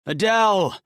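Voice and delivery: high, loud call through a hole; desperate yell for his wife; yelled name. Acted. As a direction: shout her name to be heard inside. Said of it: "and yelled".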